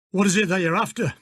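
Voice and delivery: put-on broad northern English accent; gruff Yorkshire-style stable manager. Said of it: "he asked in a broad northern accent".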